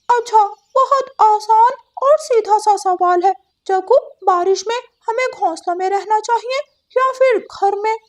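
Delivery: a chirpy parrot quizzing the class; parrot teacher voice. Acted.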